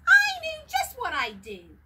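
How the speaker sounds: sudden excitement. Acted. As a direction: blurt the idea out with glee. Onceler's sudden joy.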